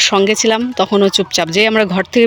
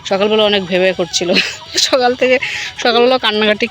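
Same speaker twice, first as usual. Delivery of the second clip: ordinary talk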